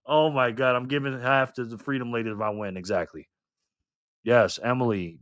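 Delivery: ordinary talk, nothing marked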